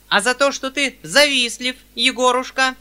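put-on a sharp old woman needling her son; old lady's reproach. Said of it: "промямлила опять генеральша". (громкий упрёк старой генеральши)

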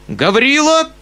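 very high loud command; cry out. A narrator's reading. Uncle orders Gavrila, shouting. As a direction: order the servant, shaken. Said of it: "вскричал дядя".

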